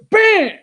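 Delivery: high, loud exclaimed bam; explosive high-pitched burst; punchy impact word. Real, not acted. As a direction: hit it like a sudden blow. Punches out Collins's hit.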